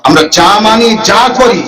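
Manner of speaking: usual speaking voice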